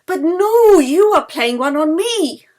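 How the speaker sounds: loud, high denial; alarmed protest. Acted. Yeli loudly denying she is playing a joke on Anna. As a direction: throw the accusation right back.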